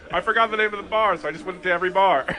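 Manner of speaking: loud teasing parody of a friend; mocking imitation. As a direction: ham up his lame excuse, loud and quick.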